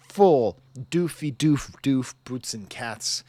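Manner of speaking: beatbox the drum machine's thump; beatbox drum imitation. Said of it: "really fast drum machine".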